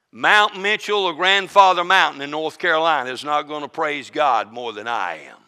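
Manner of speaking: ordinary talk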